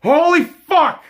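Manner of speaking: loud, high, drawn-out swear; furious outburst. Real, not acted. Fan erupting at his losing team, a loud, drawn-out swear. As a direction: explode in frustration. Fan ranting at team's play.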